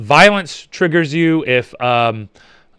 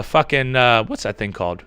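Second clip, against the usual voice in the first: usual speaking voice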